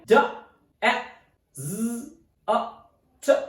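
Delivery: "d, e, z, a, t" as separate sounds with gaps. Shown as a demonstration. Teacher breaking down the noun, sounded out. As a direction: sound out "d, e, z, a, t" one by one. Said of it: "So its pronunciation is desert".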